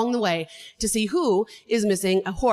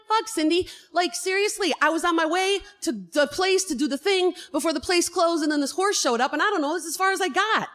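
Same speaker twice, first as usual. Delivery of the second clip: high, exasperated rant at a friend; exasperated outburst; 7 semitones higher than usual. Retold or imitated. To her friend Cindy who keeps asking for a plan, exasperated. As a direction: vent that there's no plan at all.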